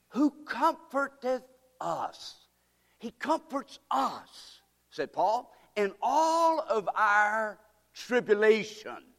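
high, slow ringing voice; preaching scripture. Acted. Preacher reading verse four, high and slow. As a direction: sing it out like a preacher.

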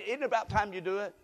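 ordinary delivery

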